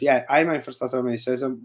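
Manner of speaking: ordinary talk, nothing marked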